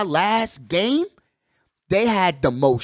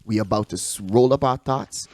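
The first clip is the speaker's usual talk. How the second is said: low radio bumper voice; 7 semitones lower, 5 dB quieter than usual. Acted. Host announcing the smoke break, low announcer voice. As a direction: lay it down like a late-night radio ad.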